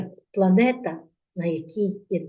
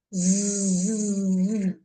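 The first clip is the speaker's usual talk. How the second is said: buzzing zzz. Shown as a demonstration. Imitating the rocket motor for children: steady buzz. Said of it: "Як гуде її мотор".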